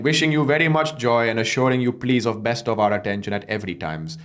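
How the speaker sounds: quick, lightly put-on Indian English reading; Indian accent, fast. Reading the relative's sign-off aloud in a quick Indian accent. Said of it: "great performance from you".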